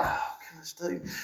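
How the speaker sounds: breathy under-the-breath exasperation; whispered aside. Real, not acted. Preacher whispering in disbelief at the story. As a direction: mutter it to yourself, unvoiced. Aside after quoting wife.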